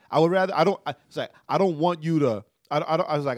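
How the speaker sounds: usual speaking voice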